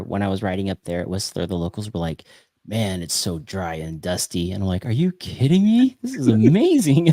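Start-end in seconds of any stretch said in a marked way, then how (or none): 2.7-4.5 slow, low mock complaint; grumbling locals. 4.8-7.1 high, loud retold amazement breaking into laughter; incredulous delight. Retold thrilled reply to complaining locals.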